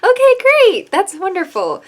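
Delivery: high, loud mock-cheerful okay great; sarcastic. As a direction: fake enthusiasm over panic. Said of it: "I'm like".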